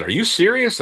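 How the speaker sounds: high, startled disbelief; imitating the neighbor's alarmed question; shocked disbelief. Neighbor reacts to the hook in the thumb, shocked.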